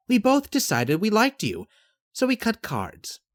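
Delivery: high, assured character voice; matter-of-fact Yvette. Acted.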